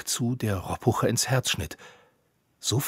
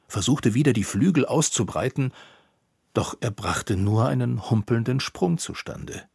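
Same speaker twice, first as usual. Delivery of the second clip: ordinary delivery, nothing marked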